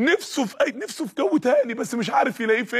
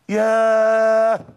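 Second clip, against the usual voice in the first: a long, louder drawn-out "yaah" of pain; stretched, louder pained cry; long pained exclamation; 6 dB louder, much slower than usual. Acted. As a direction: let it ring out like a blow to the heart. The blow lands on his heart.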